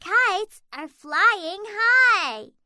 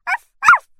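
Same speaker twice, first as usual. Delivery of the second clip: puppy barking; 14 dB louder than usual. Acted. Puppy barks.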